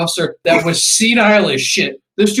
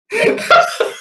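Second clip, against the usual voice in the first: real high-pitched cackle; laughing. Real, not acted. Storyteller cracking up at the commanding officer's weekend, laughing. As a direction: burst of high, wordless laughter.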